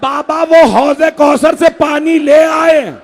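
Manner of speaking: high, very slow, drawn-out cry; dying son calling to his father, wailed high and slow; wailed lament. Acted. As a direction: stretch every word into a mourning wail. Dying son's words wailed.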